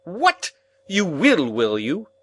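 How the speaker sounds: loud, high indignant bluster; blustering outburst. Acted. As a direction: cut him off with a bluster. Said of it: "my guardian blustered out".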